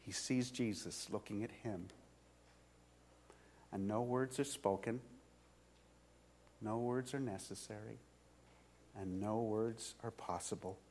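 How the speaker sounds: preacher goes quiet as Jesus looks at Peter, hushed. A narrator's reading. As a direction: let the voice drop into stillness. Hushed moment of Jesus' gaze.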